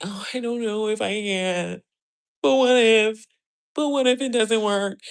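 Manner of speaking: lightly voiced hesitant worrier; a hint of nervous what-ifs; mock timid doubter. Mimicking a scared viewer doubting herself, lightly nervous. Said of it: "you sitting back".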